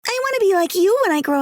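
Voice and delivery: put-on high, fast and bright as a child; little girl voice. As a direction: play the little girl, eagerly. Child character's line in story.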